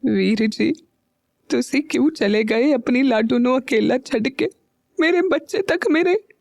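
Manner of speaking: put-on an old aunt talking to her dead brother, voice choking; choked voice. A narrator's reading. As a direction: let the throat close up as she mourns her brother. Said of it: "बुआ जी रूंधी आवाज में खुद से ही बोली".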